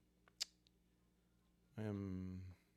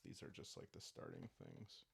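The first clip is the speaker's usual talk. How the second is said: whisper; 14 semitones lower, 14 dB quieter than usual. Real, not acted. Muttering aside while filling slots.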